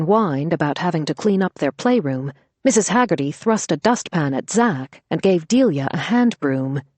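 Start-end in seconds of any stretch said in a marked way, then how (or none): none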